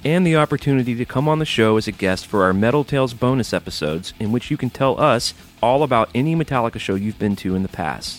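punchy, upbeat ad read; energetic scripted announcement; promo read. Real, not acted. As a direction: greet listeners like a podcast sponsor spot. Reads Patreon promo.

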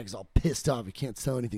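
ordinary talk

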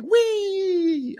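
put-on very high child's squeal of delight; spontaneous child leaping out of the van, squealing; gleeful whee. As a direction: squeal like a kid let loose. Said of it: "They're like".

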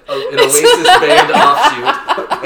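slow, high, laughing line; laughing while joking. Real, not acted. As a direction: laugh through the words. Host joking about Oasis.

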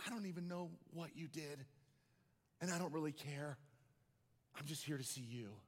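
soft, gentle, quiet comfort; tender reassurance. Acted. Father reassuring his crying daughter, soft and tender. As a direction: hush her fears, tenderly.

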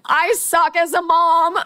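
high, drawn-out comic wail; mock crying. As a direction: wail it slowly, hamming up the despair. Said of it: "I'm in front of Trent crying".

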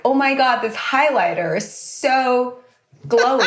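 ordinary delivery

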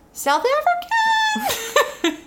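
high, drawn-out joke South African; South African accent. Shown as a demonstration. Listing accents, demonstrates one.

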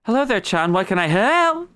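put-on high character voice, lifted throughout; high-voiced greeter. Game master's character greets Chan, high put-on voice.

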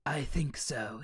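soft whisper; hushed menacing whisper; whispered reply. Acted. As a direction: breathe it like a sinister figure. Virus voice whisper.

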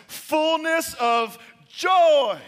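slow, loud, drawn-out climax; preaching shout. Real, not acted. Preacher lifting the congregation: slow triumphant shout. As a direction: belt it out like a revival.

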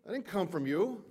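loud, high-pitched fast sermon delivery; rising pulpit fervour; preaching at full force. Acted. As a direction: preach it out to the back row. Preaching on giving.

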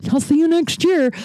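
gleefully hammy goodbye; corny sign-off joke. Acted. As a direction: deliver the cheesy line with relish. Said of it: "I hate it when people say this".

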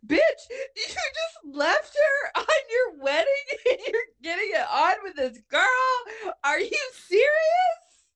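high, laughing-crying disbelief; mock outraged yelling. Friend retelling scolding the character, high, laughing, outraged. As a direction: yell at her in scandalized disbelief.

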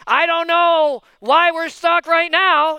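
loud, slow mock wonder; feigned cluelessness. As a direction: play dumb about the obvious snag. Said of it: "pretend like it's not there".

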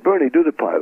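high, insistent prodding; eager mock command; pushy agent. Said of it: "beginning of really being pushy".

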